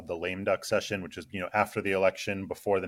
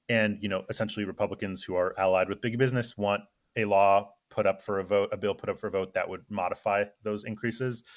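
ordinary talk

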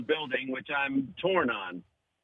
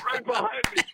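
very high, laughing squeal. Real, not acted. Comic cracking up at own punchline, laughing. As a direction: squeal it through real laughter.

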